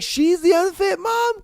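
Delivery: high, loud incredulous question; a real incredulous outburst. Real, not acted. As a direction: throw it back in disbelief. Incredulous at the mistress.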